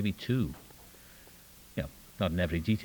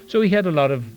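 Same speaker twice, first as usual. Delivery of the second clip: laughing through the words, higher and louder; 7 semitones higher, 8 dB louder than usual. Real, not acted. Telling the meeting about the teacher, laughing. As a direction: let the laugh spill over the whole line.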